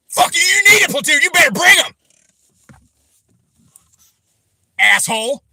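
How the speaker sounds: loud, high-pitched enraged shout; real rage bursting out; furious yelling. Real, not acted. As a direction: scream the threat, red-faced. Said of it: "I'm real fucking heated tonight".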